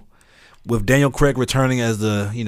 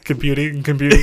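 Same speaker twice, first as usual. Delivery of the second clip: mock robot, stuck processing; lightly voiced computer, teasing; 3 semitones higher than usual. Teasing his friend as a stalled computer, lightly robotic. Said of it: "He was like".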